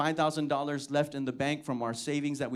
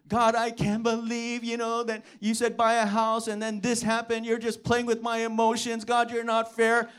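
higher, louder complaining to God; mock-aggrieved prayer; grumbling prayer; 4 semitones higher, 4 dB louder than usual. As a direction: whine it out at God like a let-down child. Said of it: "I was grumbling, complaining to God".

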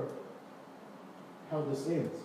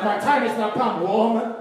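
raised, loud mock talking-back; mock defiant son; 4 semitones higher, 6 dB louder than usual. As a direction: declare it loudly, playing a cheeky son. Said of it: "if I talk like that to my mom".

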